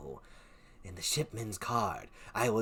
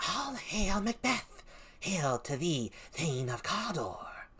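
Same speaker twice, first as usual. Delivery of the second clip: high witch hail; 6 semitones higher than usual. Acted. Second witch, high then whispered.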